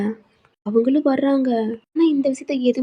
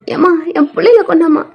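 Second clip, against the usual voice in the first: calling out; 4 semitones higher, 7 dB louder than usual. Acted. Wife calls for her babies.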